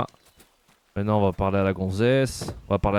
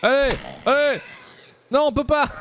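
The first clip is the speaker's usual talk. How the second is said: high frantic yelling; frantic shout; 14 semitones higher than usual. Real, not acted. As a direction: yell in panic. Frantic fighting, frustration.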